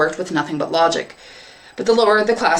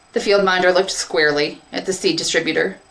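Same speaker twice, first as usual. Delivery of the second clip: plain reading voice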